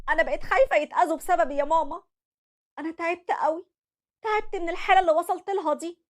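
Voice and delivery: high, hurried, worn-out pleading; distraught daughter. Acted. Daughter begging her mother to take the kids, distraught. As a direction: beg your mother for help, at the end of your rope.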